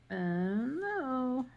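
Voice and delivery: high drawn-out mock-resigned no; wry no. Real, not acted. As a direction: answer yourself with a wry no. Answering own question.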